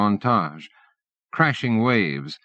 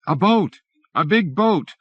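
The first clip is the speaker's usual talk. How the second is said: higher, louder call of a boat sighted; yelling; 9 semitones higher, 3 dB louder than usual. A narrator's reading. Passengers spotting a boat, yelling. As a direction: shout it out across the deck. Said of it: "Yells break out".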